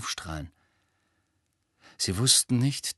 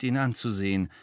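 ordinary delivery

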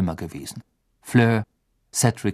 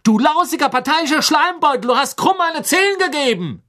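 high, loud, furious tirade; screeching outrage held to the end; angry roar; 5 dB louder than usual. A narrator's reading. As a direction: hurl the insult at full pitch. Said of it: "brüllte Ron zornig".

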